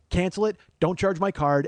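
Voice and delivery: acting out irate, stubborn texts; furious texting. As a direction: hammer out the same order angrily. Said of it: "I'm texting furiously".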